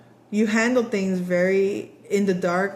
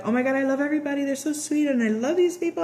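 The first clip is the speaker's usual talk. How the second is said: rushed, higher gushing over coworkers; mock-gush about loving everybody, fast and high; gushing new hire; 7 semitones higher, faster than usual. New office worker raving about coworkers, excited and fast. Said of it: "and you're like".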